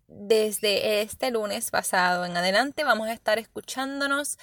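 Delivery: ordinary talk, nothing marked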